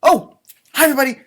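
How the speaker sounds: loud, high cheery hello; startled then cheerful hello to everyone; excited greeting. Real, not acted. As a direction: burst in brightly to greet the viewers. Greeting viewers at video start.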